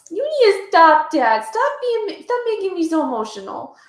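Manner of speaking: loud, high teasing protest; playful mock telling-off, loud and high; mock scolding. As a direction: pretend to tell him off. Mock scolding a viewer nicknamed Dad.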